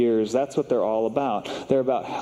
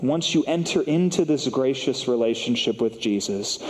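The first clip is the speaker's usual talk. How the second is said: usual speaking voice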